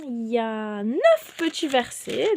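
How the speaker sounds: ordinary talk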